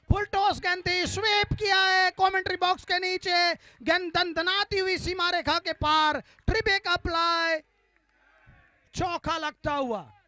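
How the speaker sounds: high, loud shouted boundary call; excited cricket commentary. Real, not acted. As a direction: shout the four with delight. Sweep goes for four. (जोशीली cricket commentary)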